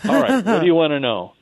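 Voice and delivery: a hint of a put-out, grumbling old guide; grumpy curmudgeon. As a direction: play him mildly annoyed at being bothered. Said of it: "Her guide is kind of a curmudgeon".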